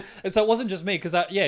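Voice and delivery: fast laughing relief. Real, not acted. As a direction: laugh through it quickly. Relief at shared experience.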